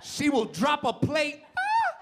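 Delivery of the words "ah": high yelp then pleased; startled yelp. Acted. Wife startled by breath on neck, yelps. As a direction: cry out, caught off guard.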